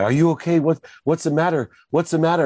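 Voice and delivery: high, alarmed imitation of a worried mother; mock alarm, pitched well up; retold terrified mom. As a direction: fire off worried questions as a frightened mom. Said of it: "my mom gets terrified".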